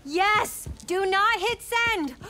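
high, loud urgent yell; alarmed shout. Acted. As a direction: stop him before he posts. Stopping a bad post.

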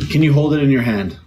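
normal talking voice

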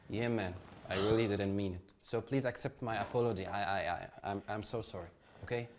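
sincere apology. Acted. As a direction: apologize gently and humbly. Remorseful apology after fight.

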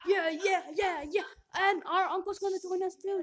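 loud, high, thrilled; excited shout. Real, not acted. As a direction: shout the good news with glee. Excited news about uncle.

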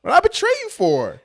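high, outraged mock protest; an incredulous husband answering his wife; indignant husband. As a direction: throw the question back at her in disbelief. Voicing the husband's indignant comeback.

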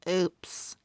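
ordinary delivery, nothing marked

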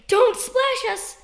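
high, slow, drawn-out grown-up warning; mock grown-up plea. Grown-ups at the pool begging kids, high and slow. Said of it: "They say".

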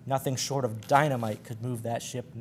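ordinary delivery

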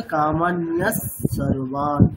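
কামান্যা সর্বান with the visarga spoken as a held স; correct sandhi pronunciation. Shown as a demonstration. Teacher showing students how to pronounce it. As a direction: run কামান্যা into সর্বান, doubling the s. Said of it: "মানে উচ্চারণটা কেমন হবে?".

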